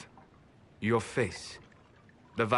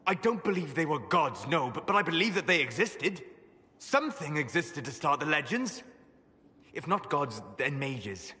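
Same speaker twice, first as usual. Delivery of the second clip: high-pitched, argumentative rebuttal; heated insistence; 6 semitones higher than usual. Acted. Arguing with a companion about elven gods, heated. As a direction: argue the point, pitch riding high.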